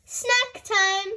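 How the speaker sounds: high call across the room. A narrator's reading. Nurse Fox calling the children, cheerful. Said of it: "called Nurse Fox".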